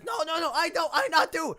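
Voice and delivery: high, loud goblin pleading; protesting goblin. Acted. Goblin denies shooting to the orc, frantic high protest. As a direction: deny it frantically in broken speech. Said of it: "the goblin says".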